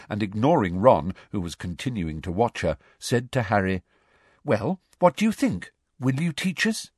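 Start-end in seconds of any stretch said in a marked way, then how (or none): 4.4-6.9 put-on raised girlish voice, higher; lightly voiced young girl; Hermione voice. A narrator's reading.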